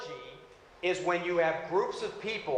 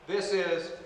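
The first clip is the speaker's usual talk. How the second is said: ordinary delivery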